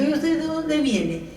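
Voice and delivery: lightly voiced indignant woman, incredulous; annoyed old lady. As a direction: play the irritated señora scolding.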